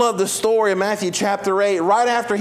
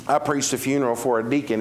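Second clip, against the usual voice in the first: ordinary talk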